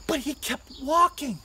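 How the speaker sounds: usual speaking voice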